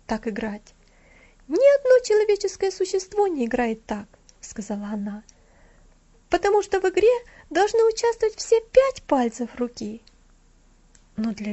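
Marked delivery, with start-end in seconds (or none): 1.5-4.1 put-on high child voice, louder; a small girl correcting her pupil; bossy little girl, voiced high. Alida, a little girl, scolds. 6.3-10.0 put-on high child voice, louder; a small girl correcting her pupil; bossy little girl, voiced high. Alida, a little girl, scolds.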